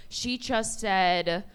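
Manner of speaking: ordinary talk, nothing marked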